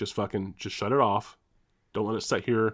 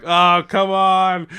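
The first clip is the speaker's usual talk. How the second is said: drawn-out, louder groan of disbelief; exasperated groan; 8 semitones higher, 9 dB louder, much slower than usual. Retold or imitated. Car bursting steam at the window, loud drawn-out groan. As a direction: stretch it out in despair.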